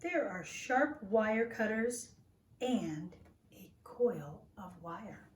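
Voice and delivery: lively, expressive picture-book reading; reading to children. Acted. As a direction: read it like a storybook to little listeners.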